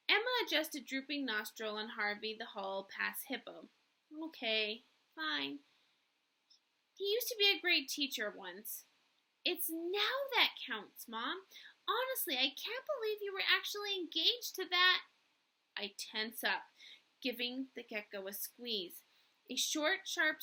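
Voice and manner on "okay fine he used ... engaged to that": lightly voiced earnest young teacher; a hint of a fretful young woman.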